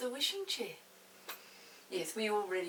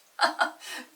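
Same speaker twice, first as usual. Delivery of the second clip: loud, high hearty laugh; a mother laughing at her children; mother's laugh; 6 semitones higher, 13 dB louder than usual. Acted. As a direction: laugh out indulgently. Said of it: "Mother laughed".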